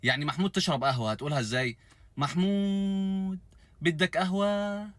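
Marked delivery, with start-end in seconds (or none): 2.2-4.9 high, slow, stretched-out Syrian drawl; mock Syrian accent. Shown as a demonstration. Mocking Syrian speech to teach a friend, drawn out. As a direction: stretch every vowel, coy and lazy, high up. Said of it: "أي كلمة هتقولها هتمطها وتمدها قوي بدلع كده".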